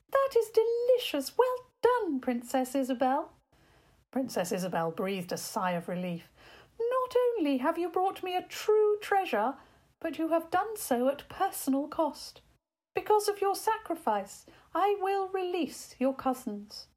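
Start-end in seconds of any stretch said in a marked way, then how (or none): 0.1-3.3 much higher, louder regal fairy queen pleased; a delighted fairy queen giving her verdict. A narrator's reading. 6.8-16.9 a delighted fairy queen giving her verdict. A narrator's reading.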